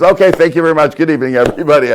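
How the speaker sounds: flustered wrap-up. Retold or imitated. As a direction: cut the event short, brightly embarrassed. Principal hurrying to end event.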